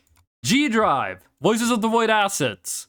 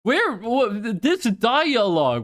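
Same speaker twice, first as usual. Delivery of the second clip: ordinary talk, nothing marked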